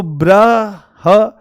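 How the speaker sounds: ब्रह stretched very long; drawn-out syllable. Shown as a demonstration. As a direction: drag out ब्रह slowly for the class. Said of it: "क्या बचा यहां पर?". (ब्रह को बहुत खींचकर बोलना)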